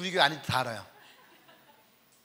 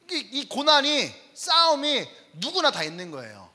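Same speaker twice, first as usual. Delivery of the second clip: loud, raised sermon voice; forceful preaching; 5 semitones higher, 8 dB louder than usual. Real, not acted. Preacher exhorting believers about real faith, forceful preaching. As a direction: drive the point home to the congregation.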